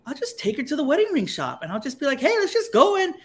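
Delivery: high, sing-song casual suggestion; fake casual. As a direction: pitch a sneaky plan as innocent fun. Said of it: "try to play it off cool".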